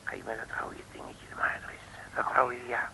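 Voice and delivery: softly whispered, almost no voice. Acted. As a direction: hush everything while picking the lock. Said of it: "Moet je zachtjes praten".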